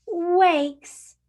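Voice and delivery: plain reading voice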